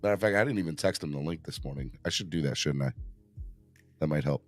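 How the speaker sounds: ordinary delivery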